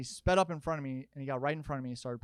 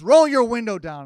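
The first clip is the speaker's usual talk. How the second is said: loud barked demand, much louder; mock angry driver; 8 semitones higher, 16 dB louder than usual. Road-rage driver ordering him at a light, loud bark. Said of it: "And he goes".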